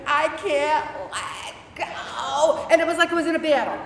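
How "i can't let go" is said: slow, drawn-out anguished yell; desperate cry. Retold or imitated. Woman at war with herself, slow despairing yell. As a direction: wail out the defeat, stretching every word. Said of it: "I'm like".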